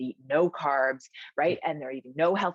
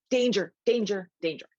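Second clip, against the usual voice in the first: high, louder warning call; body's alarm call; 6 semitones higher, 4 dB louder than usual. Acted. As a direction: sound the alarm, mock-urgent. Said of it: "your body is like".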